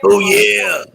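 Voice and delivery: loud, high mascot holler; Kool-Aid Man. Retold or imitated. Mascot popping through the walls, loud catchphrase holler. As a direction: burst in hollering it. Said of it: "Kool-Aid Man pops through all the walls".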